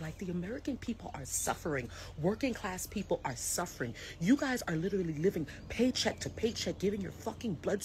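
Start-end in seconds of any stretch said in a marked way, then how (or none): 0.0-3.9 whisper. Real, not acted. Angry rant, hushed.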